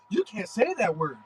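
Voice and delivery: wag a finger at him; scolding rival fans. Retold or imitated. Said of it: "they was like".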